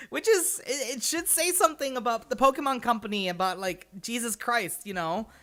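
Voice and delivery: loud, high, laughing-through-it frustration; exasperated rant. Real, not acted. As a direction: vent at the company like you can't believe it. Ranting at the Pokemon Company.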